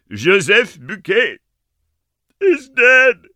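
loud, slow, high and grave; dire announcement. Acted. Ma breaks the terrible news, slow and loud. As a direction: announce the name with dread.

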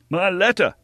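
high, loud outburst; furious cry. A narrator's reading. Young hero to the innkeeper's household, enraged cry. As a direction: demand it back in a rage. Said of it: "cried D'Artagnan".

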